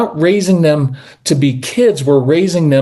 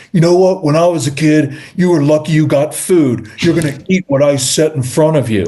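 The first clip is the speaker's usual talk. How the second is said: lightly voiced strict parent; old-school authoritarian. Coach mimicking an old-school parent at dinner, mildly authoritarian. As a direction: lay down the law like a mock old-school parent. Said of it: "old school authoritarian".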